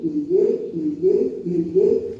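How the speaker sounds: LIGUEI pitched high on each repeat; repetitive emphasis. Retold or imitated. Pastor recounting endless calls, insistently repetitive high-pitched "liguei".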